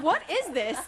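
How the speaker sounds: high, loud, laughing disbelief; retold incredulous outburst; baffled disbelief. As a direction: blurt it out high, amused and appalled. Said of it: "And I was like".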